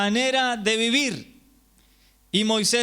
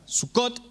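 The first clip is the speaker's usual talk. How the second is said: Sucot accented on the closing cot, pitched higher; stress on last syllable; 4 semitones higher than usual. Shown as a demonstration. Showing where the accent goes, end-stressed word. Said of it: "El acento va al final de la palabra".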